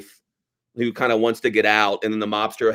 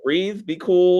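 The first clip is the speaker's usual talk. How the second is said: slow, higher, put-on voice; calm Bear quote; 7 semitones higher, much slower than usual. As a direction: draw it out slowly to calm him down. Imitating Bear calming Chili.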